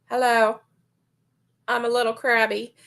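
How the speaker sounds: normal talking voice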